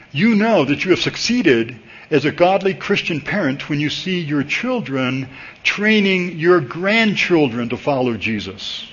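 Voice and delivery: ordinary talk, nothing marked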